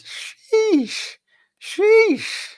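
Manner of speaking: squeaky, drawn-out high exclamation; high falsetto reaction; high-pitched sheesh. Retold or imitated. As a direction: read it out way up high, stretched long. Reads out fan's exclamation.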